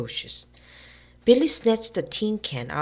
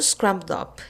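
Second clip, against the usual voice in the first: ordinary delivery, nothing marked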